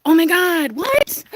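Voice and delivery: sudden high cry of shock; real startled outburst; shocked surprise. Real, not acted. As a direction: gasp out your shock at the damage. Spots holes dug in garden.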